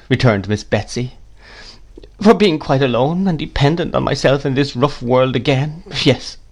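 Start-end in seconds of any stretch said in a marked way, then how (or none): 2.2-6.4 lightly voiced plaintive mother, a little higher; a touch of sorrowful self-pity; tearful young mother. A narrator's reading. Mother admits he spoilt her, sorrowful.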